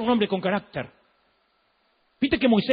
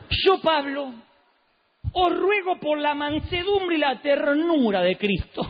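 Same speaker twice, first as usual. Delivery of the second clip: much higher, slow, syrupy mock-gentle voice; Pablo con ese tono; 7 semitones higher, slower than usual. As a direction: plead with sugary meekness, holding back force. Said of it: "pero no con este tono". (Pablo rogando, agudo, lento y empalagosamente manso)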